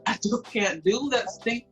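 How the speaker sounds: ordinary delivery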